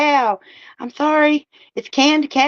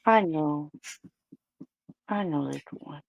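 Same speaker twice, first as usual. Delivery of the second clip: much softer, low comforting murmur; soothing; 9 semitones lower, 13 dB quieter than usual. Real, not acted. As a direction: hush the little one gently. Soothing the baby squirrel.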